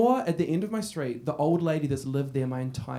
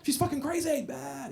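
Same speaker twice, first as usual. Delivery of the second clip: put-on higher jeering teen shout; teen mock scare; 9 semitones higher, 5 dB quieter than usual. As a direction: taunt like a bratty teenager. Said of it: "we were like".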